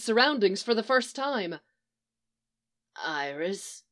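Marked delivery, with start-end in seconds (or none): 3.0-3.8 low, soft croak of a name; weak croak, much lower and softer. Acted. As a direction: rasp it out after long silence. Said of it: "he croaked".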